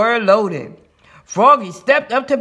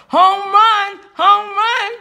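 high-pitched jubilant yell; high, sustained cheer; excited shout; 11 semitones higher, 4 dB louder, slower than usual. A narrator's reading. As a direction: shout it out like a young player. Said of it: "and shouted".

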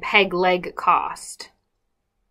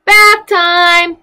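higher, loud call; 11 semitones higher, 12 dB louder than usual. Acted. Pirate mommy calls her little pirates to bath time. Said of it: "calls out the pirate mommy".

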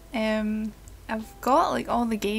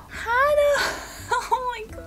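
much higher, laughing cry of recognition; delighted shriek; 12 semitones higher than usual. Real, not acted. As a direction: squeal the name in glee. Finds an old friend's name.

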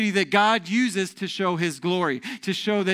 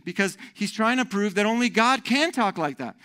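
ordinary talk, nothing marked

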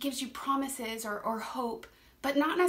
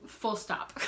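ordinary talk, nothing marked

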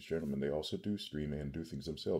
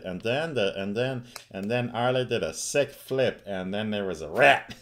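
louder, higher goblin narration; gleeful goblin telling a story; 4 semitones higher, 10 dB louder than usual. Shown as a demonstration. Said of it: "In, in the goblin voice".

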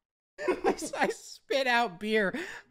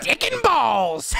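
slow shouted cheer; 4 dB louder, slower than usual. Real, not acted. Cheering a crude joke.

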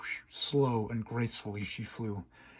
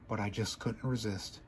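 ordinary delivery

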